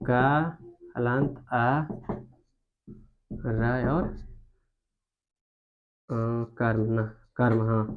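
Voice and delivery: very slow, soft sound-by-sound split; syllables broken apart very slowly; slow spelled breakdown. Shown as a demonstration. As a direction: draw each part out slowly. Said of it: "जब तोड़ेंगे तो ऐसे तोड़ेंगे".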